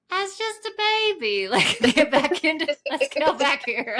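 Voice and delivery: put-on high, drawn-out baby-talk voice; babying coo. Talking herself down like a baby, cooing.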